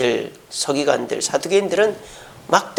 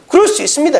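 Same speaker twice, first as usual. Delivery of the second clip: higher, louder keen promise; eager disciples; 7 semitones higher, 3 dB louder, faster than usual. Retold or imitated. Disciples boasting to Jesus, higher and keen. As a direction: blurt it out like a proud student. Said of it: "이 제자들이".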